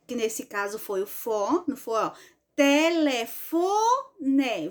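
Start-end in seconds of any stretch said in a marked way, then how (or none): 2.6-4.7 "Telefone" slowly, dwelling on FO before NE; pause on FO. Shown as a demonstration. Teacher proving the strong syllable, "Telefone" lingering on FO. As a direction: hold FO in "Telefone", then a little pause. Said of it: "Veja que deu uma pausazinha no fon".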